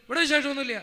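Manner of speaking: a brusque, loud father's voice, playing it for laughs; gruff father on the phone. Father brushing off his son on the phone, gruff and loud. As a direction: bark it out like a blunt old father.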